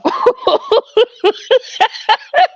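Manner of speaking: rising squealing laugh; real laughing fit; high cackle. Real, not acted. Laughs at own joke.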